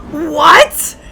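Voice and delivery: ordinary talk, nothing marked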